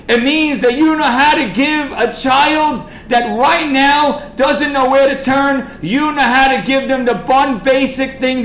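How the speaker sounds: high, loud preaching with punched key words; impassioned sermon appeal. Real, not acted. Preacher urging the room to help a child, impassioned appeal.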